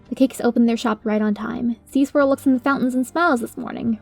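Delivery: ordinary delivery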